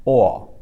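"o" as loud open aw; pinyin O. Shown as a demonstration. Teacher repeating it for learners, "o" as open aw. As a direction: hold "o" open without a closing w. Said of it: "doesn't round at the end".

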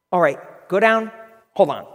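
loud mock orders; gruff boss. Boss sends employee off then stops him, gruff. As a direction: imitate a boss dispatching someone, then halting them. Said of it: "he's just gruff".

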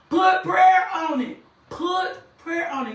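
high, loud, slow exhortation; preaching shout. Real, not acted. Preacher urging listeners facing adversity, loud slow shout. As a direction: shout it out slowly, each word driven home.

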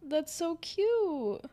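high, slow cooing to a pet. Real, not acted. Cooing over the cat.

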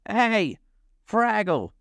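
high, excited dog's voice; high-pitched yapping hail; barked call. A narrator's reading. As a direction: call after it like an eager dog. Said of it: "Sprocket barked".

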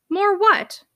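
high-pitched challenging question; sharp interruption. Acted. Uncle cutting off his niece mid-sentence, testy. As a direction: cut in, demanding the word.

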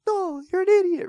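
very high mock outrage; hammed-up Italian driver hurling an insult; going off. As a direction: squeal it in indignation. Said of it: "He's going off".

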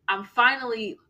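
usual speaking voice

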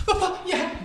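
put-on high, giggling kid begging; nervous laughing plea. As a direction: laugh from nerves while pleading with dad. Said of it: "te daban con el cinturón".

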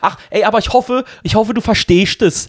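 high, chatty Swabian woman imitation; mimicked Swabian brush-off; Swabian accent. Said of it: "wie sich das anhört auf Schwäbisch".

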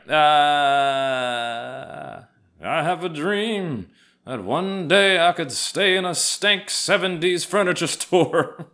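high, slow preacher boom; Martin Luther King voice. Pretend. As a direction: orate it like a civil rights sermon. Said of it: "Yes, Martin Luther King Junior".

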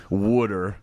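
Philly-accent "wooder" for water; Pennsylvania accent. Shown as a demonstration. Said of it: "I know the Pennsylvania accent".

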